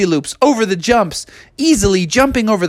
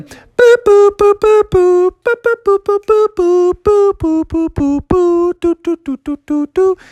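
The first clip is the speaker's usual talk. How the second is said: high synthetic boops and doos; high-pitched video game beeping; game start-up jingle; 13 semitones higher, 3 dB louder than usual. Acted. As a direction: imitate an old console's electronic tune. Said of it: "it started going".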